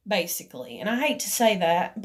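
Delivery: ordinary talk, nothing marked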